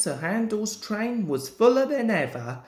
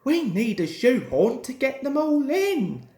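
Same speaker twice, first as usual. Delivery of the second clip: exclaiming; 6 semitones higher than usual. A narrator's reading. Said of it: "exclaimed the guard".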